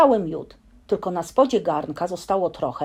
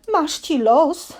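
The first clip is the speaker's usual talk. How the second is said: slow, louder mock moan from inside a pot; 8 semitones higher, 5 dB louder, slower than usual. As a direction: groan it out slowly, a bit hammed up. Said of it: "jęczał Puchatek z głębi garnka".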